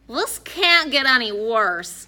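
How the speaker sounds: put-on drawn-out boyish grumble; dismayed complaint.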